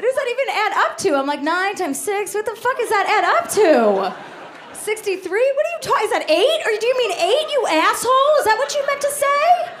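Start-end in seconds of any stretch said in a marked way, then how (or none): 0.0-1.2 fast, high-pitched indignant question; mock exasperation. Baffled listener doing month math, mock exasperated. Acted outrage at age in months. 1.4-9.7 high, rising comic outrage; mock exasperated rant. Baffled listener berating parent over months, exasperated.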